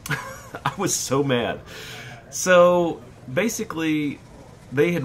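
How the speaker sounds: laughing through the words, slow; laughing as he admits how mad he was. Real, not acted. Son retelling his anger to viewers, laughing.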